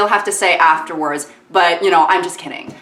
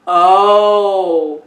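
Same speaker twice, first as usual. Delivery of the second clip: ordinary talk, nothing marked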